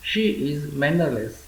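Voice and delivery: very slow, every word laid out for learners; slow model reading. Shown as a demonstration. Teacher reading an English sentence for Gujarati learners, very slow. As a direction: spell it out slowly so students can copy.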